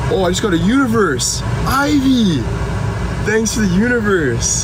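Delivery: thrilled, high-pitched gratitude; excited thanks. Real, not acted. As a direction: squeal your thanks to the donor. Thanking a viewer for a gift.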